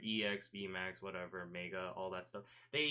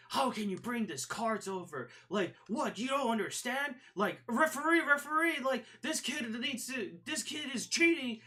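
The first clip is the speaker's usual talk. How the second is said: high, loud mock outrage, calling for the referee; pissed-off kid; 12 semitones higher, 4 dB louder than usual. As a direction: imitate an angry player yelling for the ref. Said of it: "he got so pissed and angry, being like".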